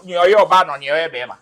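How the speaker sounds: usual speaking voice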